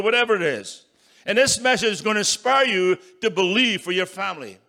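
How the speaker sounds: building revival preaching; full-force preaching. Acted. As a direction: rouse the congregation.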